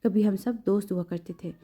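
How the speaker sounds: ordinary delivery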